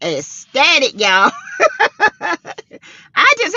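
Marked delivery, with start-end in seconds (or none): 1.3-2.1 high, bright burst of laughter; delighted laugh. Real, not acted. Host laughing with joy at her numbers, delighted laugh.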